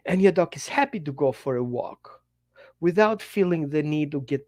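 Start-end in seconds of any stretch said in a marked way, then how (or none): none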